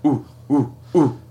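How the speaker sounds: short hooting ape calls; ape hoots. Shown as a demonstration. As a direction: hoot like an ape. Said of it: "if you were to make baboon sounds, it would be".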